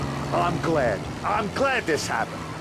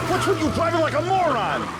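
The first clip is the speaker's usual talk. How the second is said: higher-pitched irritated outburst; angry yell; 7 semitones higher than usual. Acted. Boss berating his driver, angry yell.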